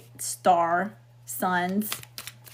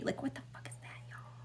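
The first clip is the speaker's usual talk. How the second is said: sudden hushed whisper; whispered aside; 19 dB quieter, faster than usual. Real, not acted. Whispering to viewers in disbelief at the card, hushed.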